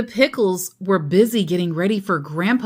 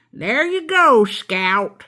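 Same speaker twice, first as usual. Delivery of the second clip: higher, louder hearty greeting; hearty grandpa; 5 semitones higher, 5 dB louder, slower than usual. Acted. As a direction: hand over the cap jovially. Grandpa giving cap.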